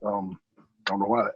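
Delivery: fighting back tears, barely getting it out; choked up. Real, not acted. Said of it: "I don't know why I'm getting emotional".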